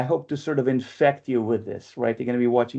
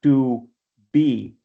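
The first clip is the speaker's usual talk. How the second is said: "To", a pause, then a stressed "be"; strong second syllable; 3 dB louder than usual. Shown as a demonstration. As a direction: split "To be" into separate beats, landing on "be". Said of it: "that's where the strong syllable is".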